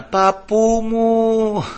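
very drawn-out, high imitated wail; child's cry. Child calling grandfather through the door, imitated long wail. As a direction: hold the call long like a child crying out. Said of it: "αρχίζει να φωνάζει εμένα".

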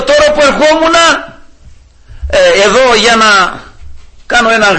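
high public call with halting pauses; re-enacted shouting. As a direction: address a crowd from a distance. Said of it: "αρχίζω να φωνάζω".

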